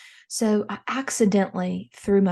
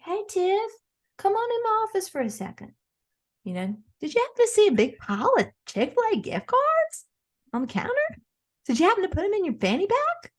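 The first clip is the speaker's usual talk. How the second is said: high, syrupy fake-friendly questioning; fake sweet boss; 12 semitones higher than usual. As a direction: play the innocent boss luring her in. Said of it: "I'm gonna have to say".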